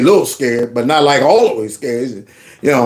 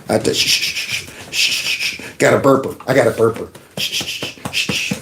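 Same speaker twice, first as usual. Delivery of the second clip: hushing the dog; 3 semitones lower, 5 dB quieter than usual. Real, not acted.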